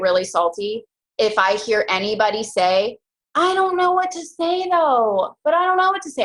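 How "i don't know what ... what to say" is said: whiny team member. Retold or imitated. Mocking a recruit who won't follow up, high whine. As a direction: whine like you can't find the words. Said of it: "[name] imitates our voices ... when we whine".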